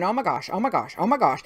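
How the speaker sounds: nervous tizzy. Retold or imitated. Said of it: "work myself up into this nervous tizzy".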